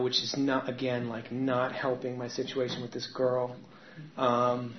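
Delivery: usual speaking voice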